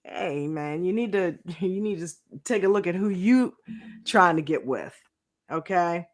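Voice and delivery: usual speaking voice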